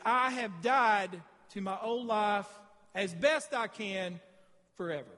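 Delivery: each word of the vow said slowly and deliberately, with weight; slow solemn vow. Shown as a demonstration. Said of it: "what you're saying today when you get baptized".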